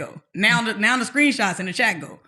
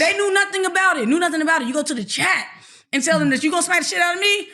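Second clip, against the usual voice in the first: outraged; 7 semitones higher, faster than usual. Real, not acted. Outraged about the chat threat.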